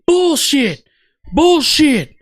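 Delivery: high, hammed-up indignant preaching; mock freaking out. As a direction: imitate a man outraged, drawing out the words. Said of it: "He's, like, freaking out".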